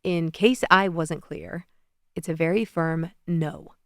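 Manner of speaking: slow and firm, each word weighed; emphatic. Acted. Alexei to viewers, slow emphatic teasing refusal. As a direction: lay it down firmly, no arguing. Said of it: "emphatically says".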